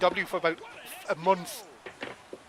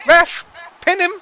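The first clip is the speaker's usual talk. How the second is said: loud high yells at the ref; yelling from the sidelines; 7 semitones higher, 11 dB louder than usual. Real, not acted. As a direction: shout urgently, like a fan ringside. Yelling at the ref during match.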